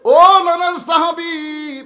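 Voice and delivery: loud, high, drawn-out call. Acted. Grandsons hailing their grandfather's companion, loud drawn-out call. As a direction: cry out with long held vowels.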